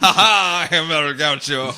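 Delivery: loud boastful laughing hero; El Gaucho. Retold or imitated. El Gaucho posing for fans, boastful laugh. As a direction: boom it out, full of himself. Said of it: "he's like".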